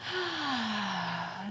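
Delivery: long, soft breathy exhale; soft releasing sigh; sigh of relief. Real, not acted. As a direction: let out a slow, relieved sigh. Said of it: "It's like a sigh of relief".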